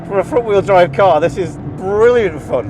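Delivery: loud, high-pitched real delight; exhilarated. Real, not acted. Driver raving about the car on track, exhilarated. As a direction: rave about it mid-corner, voice lifted. Thrilled driver on hot lap.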